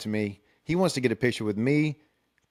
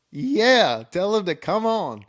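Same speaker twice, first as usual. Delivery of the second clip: much higher, louder eager yes; excited yes; 9 semitones higher, 4 dB louder, slower than usual. Retold or imitated. Agreeing to host the movie star, loud and thrilled. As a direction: blurt it out thrilled. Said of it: "I'm like".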